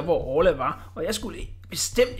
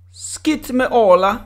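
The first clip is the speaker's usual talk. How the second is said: dismissive; 6 semitones higher, 8 dB louder, slower than usual. Acted. Cannon king brushes off.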